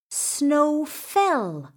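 usual speaking voice

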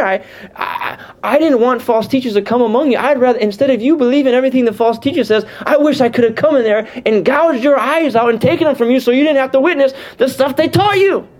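fervent apostle pouring it out; impassioned. Acted. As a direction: voice Paul burning with concern. Preacher voicing Paul's passion.

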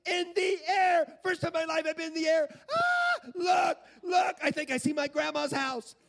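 put-on high, shouted kid amazement; boy going nuts. Boy looking out on first flight, ecstatic shouting. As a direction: shriek in wonder like a thrilled child. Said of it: "he is absolutely nuts".

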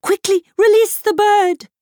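high, loud horse-like urgency; whinnying mare. A narrator's reading. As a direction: neigh the words out urgently. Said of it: "whinnied the mayor".

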